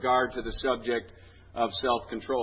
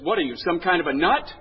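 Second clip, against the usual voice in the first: louder, higher mock outrage; exasperated scolding; 5 semitones higher, 7 dB louder than usual. Psychiatrist berating his patient, exasperated. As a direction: scold as an exasperated comic therapist.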